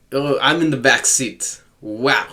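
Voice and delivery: ordinary delivery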